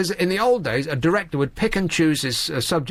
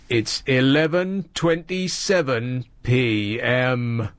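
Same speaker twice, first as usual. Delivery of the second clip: slow, lower, drawn-out Scottish voiceover; Big Brother voiceover impression; 3 semitones lower, much slower than usual. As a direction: imitate the broad Scottish announcer, slowly. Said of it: "No one speaks like that".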